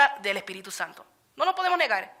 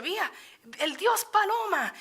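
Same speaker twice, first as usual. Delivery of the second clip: imitated scornful outcry; 8 semitones higher than usual. Teacher retelling how a man burned the lesson, imitated outcry. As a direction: imitate him crying out, pitched high and stretched.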